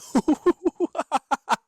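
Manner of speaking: loud, high staccato cackle; big laugh. Real, not acted. Host cracks up at own attempt, laughing loudly. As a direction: burst out laughing in a long string.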